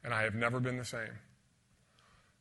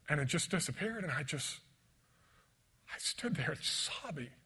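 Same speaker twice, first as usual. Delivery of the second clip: ordinary talk, nothing marked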